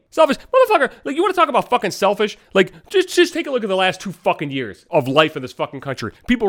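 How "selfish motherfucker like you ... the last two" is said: high-pitched indignant outburst; driven insane. Real, not acted. Podcaster fuming at people who call suicide selfish, indignant. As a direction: throw the accusation back, exasperated and high. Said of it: "that drives me fucking insane".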